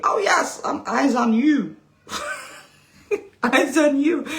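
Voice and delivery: mock menace. Mock threat "eyes on you.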